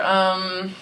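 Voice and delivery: ordinary talk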